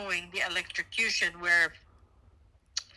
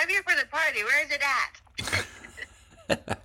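higher, louder, bouncy party voice; lively; 6 semitones higher, 3 dB louder, faster than usual. Acted. Asked for more life, she brightens the party line. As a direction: sell it like you just walked into the fun. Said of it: "A little more lively".

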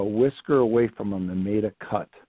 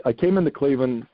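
ordinary talk

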